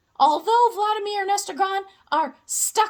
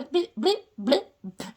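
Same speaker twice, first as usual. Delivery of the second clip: ordinary talk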